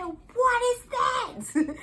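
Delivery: very high, loud and slow alarmed cry; shocked cry. Retold or imitated. Mother feeling sudden burn, loud slow shocked cry. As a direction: drag it out in pained shock. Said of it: "I said".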